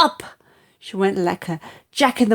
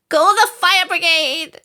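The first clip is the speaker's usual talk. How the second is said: a much higher panicked shout; sudden shout; 10 semitones higher, 3 dB louder than usual. Acted. Grandma with her stomach burning: high panicked shout. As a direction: cry for help in alarm. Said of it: "she shouted suddenly".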